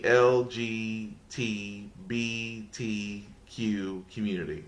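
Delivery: spelled out slowly, letter by letter; slow and careful. Real, not acted. Host carefully getting every letter of the acronym in, slow. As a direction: take each letter slowly and softly, missing none. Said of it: "I had to say it slow".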